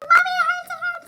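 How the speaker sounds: high-pitched wailing child imitation; screaming little cousin. Little cousin crying to her mother, imitated high wail. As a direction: wail loudly like a hurt child. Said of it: "One of my little cousins starts screaming".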